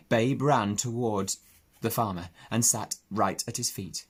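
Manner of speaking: ordinary delivery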